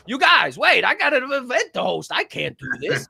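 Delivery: high, loud pleading; high frantic refusal; panicked protest. Retold or imitated. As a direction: beg them not to make him work. Protesting to restaurant staff.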